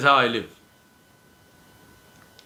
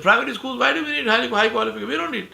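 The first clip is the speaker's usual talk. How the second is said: much higher, scornful mock voice; disdainful mimicry; 12 semitones higher, faster than usual. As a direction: imitate a dismissive man in a high-pitched, incredulous protest. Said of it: "in a very disdainful manner".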